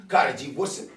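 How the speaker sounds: usual speaking voice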